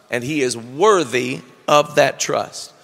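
ordinary delivery

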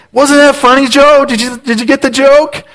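high, sing-song fake cheer; forced cheerful cover-up. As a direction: push the joke on Joe brightly. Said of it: "and I'm like".

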